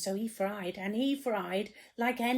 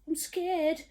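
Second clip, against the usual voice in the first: high, whimpering "I'm scared"; 7 semitones higher than usual. Acted. Storyteller voicing the cook, high and whimpering. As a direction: whimper it like a timid child. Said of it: "the cook declared".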